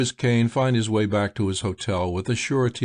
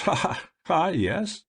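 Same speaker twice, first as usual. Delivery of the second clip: sly laugh; 7 semitones higher than usual. A narrator's reading. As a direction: laugh slyly at the joke.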